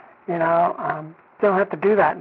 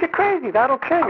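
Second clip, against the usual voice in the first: much higher, panicked warning; alarmed; 9 semitones higher than usual. Retold or imitated. Sister, shocked he wants rum, cries out in alarm. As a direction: cry out in alarm. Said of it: "She says".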